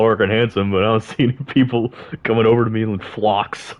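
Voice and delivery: normal talking voice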